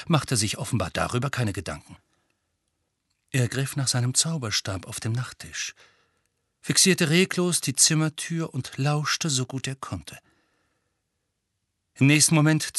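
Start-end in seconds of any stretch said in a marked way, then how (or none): none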